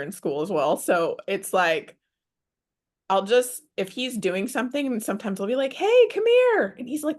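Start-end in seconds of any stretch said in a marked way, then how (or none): 5.7-6.7 high, loud, drawn-out summons; playful mom's call, pitched way up. Acted. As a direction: call your kid over in a singsong. Mom calling her son over.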